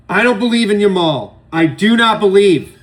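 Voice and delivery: loud, high defiant declaration; defiant rant. Real, not acted. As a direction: refuses to buy the Yamal hype, loudly. Ranting against Yamal hype.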